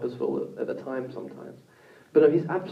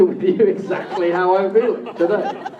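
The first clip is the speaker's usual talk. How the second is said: laughing; 5 semitones higher, 8 dB louder than usual. Real, not acted. Laughing while confessing his mood.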